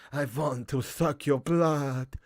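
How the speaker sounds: put-on slow, low-key vampire drawl from an old horror film; Bela Lugosi. Streamer reads a chat suggestion and answers in a slow vampire voice. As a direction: stretch it out slowly like a movie Dracula. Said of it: "Bela Lugosi".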